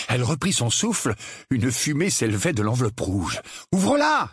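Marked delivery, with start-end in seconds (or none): 3.7-4.3 high urgent exclamation; crying out. A narrator's reading. Harry urging his aunt to open it, crying out. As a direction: call it out urgently. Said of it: "s'écria Harry".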